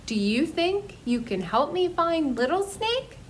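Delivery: plain reading voice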